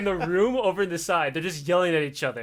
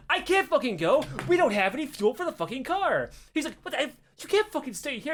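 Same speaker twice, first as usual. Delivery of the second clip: higher, half-acted angry back-and-forth; mock heated argument; 8 semitones higher than usual. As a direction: retell the fight with pitch raised, not shouting. Retold heated argument with guard.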